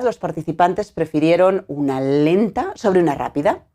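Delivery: ordinary delivery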